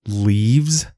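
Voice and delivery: cold, offended question; icy. A narrator's reading. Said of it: "The creature's tone was like ice".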